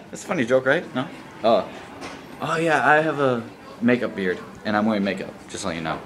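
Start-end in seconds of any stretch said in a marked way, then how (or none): none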